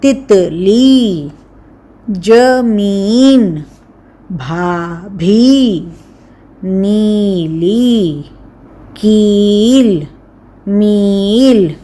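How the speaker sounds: each word drawn out slowly for the class; slow spelled-out words. Shown as a demonstration. Teacher to young pupils, drawn out slowly. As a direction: say every word very slowly and clearly. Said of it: "इसमें हम लिखते हैं इसके शब्द".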